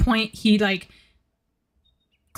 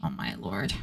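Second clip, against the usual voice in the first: muttered; 14 semitones lower, 10 dB quieter than usual. Real, not acted. Muttered aside after gushing.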